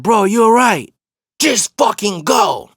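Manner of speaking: high, loud and urgent; urgent shout. Retold or imitated. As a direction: push the worried question out hard. Retold tense exchange at car.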